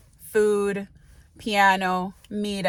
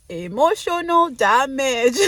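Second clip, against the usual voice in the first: meme quote; 5 semitones higher, 6 dB louder, slower than usual. Retold or imitated. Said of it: "I love that TikTok".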